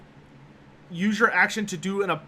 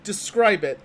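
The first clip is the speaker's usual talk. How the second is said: game master narration. Acted. GM sets the scene.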